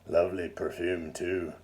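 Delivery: lightly voiced husky leer; low husky voice. A narrator's reading. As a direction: purr it like a lecherous old lord. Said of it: "In a low, husky voice".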